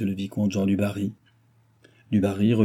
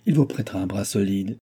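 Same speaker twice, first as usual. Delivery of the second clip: usual speaking voice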